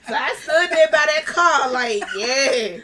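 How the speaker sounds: loud, high, laughing through the story; real amused, boisterous laughter in the words. Real, not acted. Laughing over her parking cover-up.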